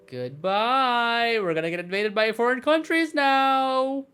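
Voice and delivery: high, slow drawn-out wail; mock doom singsong. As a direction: stretch it upward in playful despair. Mock alarm at game outcome.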